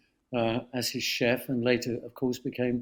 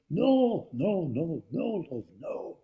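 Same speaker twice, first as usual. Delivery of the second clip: David Hockney impression; 4 semitones higher, slower than usual. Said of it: "that's a great impersonation".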